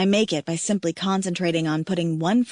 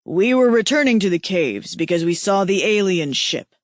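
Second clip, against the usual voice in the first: grim; 4 dB louder than usual. Acted. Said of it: "in a grim voice".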